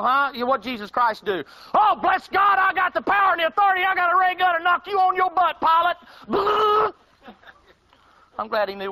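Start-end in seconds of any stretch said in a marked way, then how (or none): 1.7-6.9 hammed-up high shouting braggart; mock power-preacher. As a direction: bellow it like a blowhard you're mocking. Mocking power-claiming believer.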